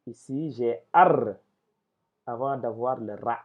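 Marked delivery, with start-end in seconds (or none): 0.9-1.3 ar said loud with a held, rolled r; doubled r. Shown as a demonstration. Teacher detaching the doubled letter, ar with a sustained r. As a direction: hold the final r of ar firmly. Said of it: "Donc, on double".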